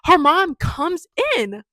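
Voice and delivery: stammering in disbelief, then loud; flabbergasted. Real, not acted. Flabbergasted at mom entering the shower.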